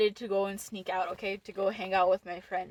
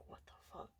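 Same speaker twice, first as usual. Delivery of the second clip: breathless whisper, barely there; whispered curse; 26 dB quieter, much faster than usual. Retold or imitated. A teen sneaking out sees mom calling, whispering in panic. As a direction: hiss it so nobody hears.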